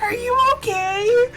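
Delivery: high-pitched imitation of a worried mom; concerned mom. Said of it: "my mom's like".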